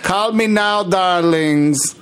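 high, put-on Jamaican psychic; attempted Jamaican accent. Shown as a demonstration. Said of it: "Can we try doing that".

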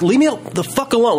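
high, quick exasperated snap; retold irritation. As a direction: act out fed-up lashing out. Acting out argument with his dad.

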